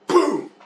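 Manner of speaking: sudden high-pitched "boom"; imitated boom. Cards bursting in the race car, exclaimed high boom. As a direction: pop out a burst noise.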